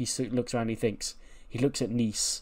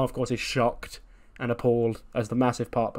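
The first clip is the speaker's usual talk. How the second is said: usual speaking voice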